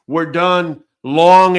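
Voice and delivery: ordinary talk, nothing marked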